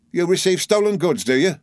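high, indignant challenge; accusing cry. A narrator's reading. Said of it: "cried Uriah".

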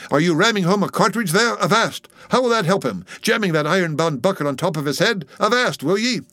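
high-pitched indignant protest; Stubb crying out. A narrator's reading. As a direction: cry out urgently to stop him. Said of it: "cried Stubb".

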